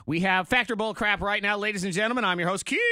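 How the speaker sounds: hyped radio game host; announcer voice. Acted. Host opens game segment.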